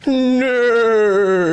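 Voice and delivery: whinny it up high; high horse neigh. Retold or imitated. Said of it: "The horse says".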